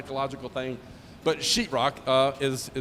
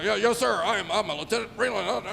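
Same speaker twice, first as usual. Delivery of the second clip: eager stiff lieutenant; 6 semitones higher than usual. Retold or imitated. Imitating Owen answering his commander.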